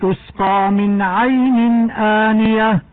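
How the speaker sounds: slow, measured Quranic chanting with clear izhar; Quran recitation. Shown as a demonstration. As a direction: recite it in tarteel, each letter shown. Teacher recites Surat al-Ghashiya. (تلاوة مرتلة هادئة)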